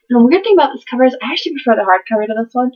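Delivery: fast rattled-off ramble; talking really fast. Real, not acted. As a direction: race through it without a breath. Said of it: "I'm talking really fast".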